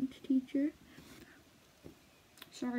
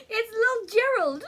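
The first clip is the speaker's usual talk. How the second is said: higher and louder, cooing; doting; 11 semitones higher, 7 dB louder than usual. Real, not acted. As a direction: coo over it like a pet. Doting on her tattoo.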